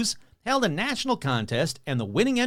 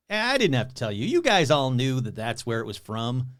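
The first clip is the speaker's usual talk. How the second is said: usual speaking voice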